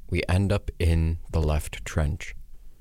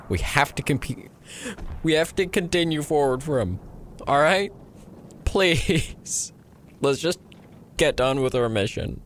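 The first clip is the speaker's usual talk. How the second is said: higher, urging plea; a touch of desperation, voice raised in pitch; strained pleading; 9 semitones higher than usual. Acted. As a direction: implore a comrade to carry on. Comrade pleading to continue mission.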